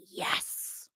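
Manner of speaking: put-on a kid's secret whispered yes. The twelve-year-old whispering his support to her, whispered. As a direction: breathe the yes, no voice. Kid answers quietly.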